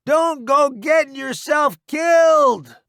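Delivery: high, slow, loud call; shouted warning. A narrator's reading. Roddy calling after departing newbie, shouted warning. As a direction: holler it after him. Wounded man calls after friend.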